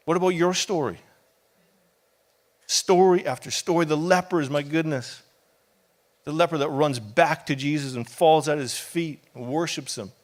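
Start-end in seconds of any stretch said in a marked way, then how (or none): none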